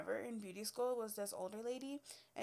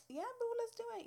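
high bubbly yes, much higher; chirpy eager agreement, pitched way up; eager chirp; 12 semitones higher than usual. Retold or imitated. To her new friend, a high bubbly yes.

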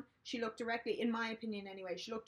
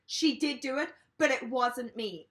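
aggressive; 2 semitones higher, 7 dB louder than usual. Retold or imitated. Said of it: "real aggressive".

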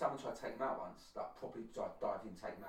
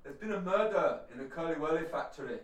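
put-on Scottish accent; 3 semitones higher, 8 dB louder than usual. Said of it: "you want a second attempt?".

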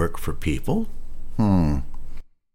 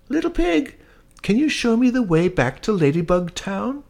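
very high, tiny lost-bug voice; ladybug voice; 4 dB louder than usual. Acted. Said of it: "said the ladybug".

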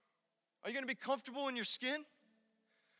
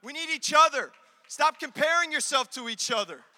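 higher, impassioned sermon exhortation; forceful preaching; 7 semitones higher, 7 dB louder than usual. Acted. Preacher urging church members to stop comparing, forceful preaching. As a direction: drive it home to the congregation.

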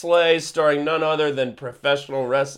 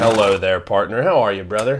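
fake cowboy accent; 6 semitones lower, 4 dB louder than usual. Answers in cowboy banter.

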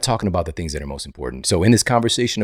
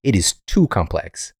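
ordinary delivery

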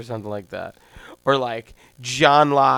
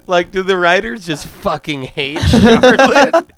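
laughing through the words; cracking up mid-rant; 6 semitones higher, 6 dB louder than usual. Real, not acted. Laughing at the writers.

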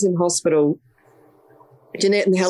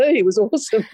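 laughing through the words, pitched up; 7 semitones higher than usual. Real, not acted. Laughing as she tells the interviewer about the orange tears. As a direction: chuckle it out, amused at the absurdity.

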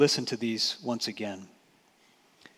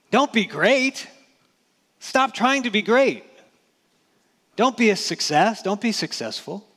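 higher, louder sarcastic scolding; mock prohibition; 7 semitones higher, 4 dB louder than usual. Preacher mocks what Jesus never told disciples, high sarcastic scolding. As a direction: bark it like a killjoy forbidding success. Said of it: "Jesus does not say".

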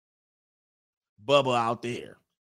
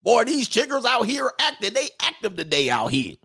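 high mock Southern drawl; 9 semitones higher than usual. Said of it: "Why they always say everything real close?".

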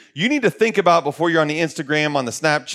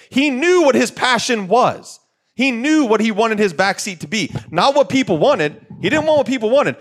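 fervent emphasis; 8 semitones higher, 3 dB louder than usual. Real, not acted. Passionate emphatic preaching.

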